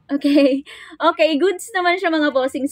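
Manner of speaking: ordinary talk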